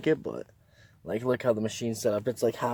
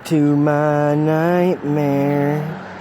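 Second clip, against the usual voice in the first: spooky announcer; 3 semitones higher, 8 dB louder, much slower than usual. Pretend. Dramatic video intro line.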